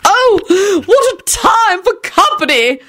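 very high, drawn-out theatrical wail; slow, high-pitched histrionics; dramatic shriek. A narrator's reading. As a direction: shriek it like an overwrought lady. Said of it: "Lady Fanshawe shrieked dramatically".

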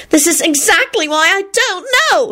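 high, upset protest; wail. A narrator's reading. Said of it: "Solange wailed".

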